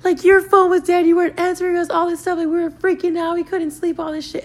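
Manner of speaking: high, wailing, tearful friend; crying friend. Retold or imitated. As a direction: squeal it out through tears. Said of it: "One of them is crying".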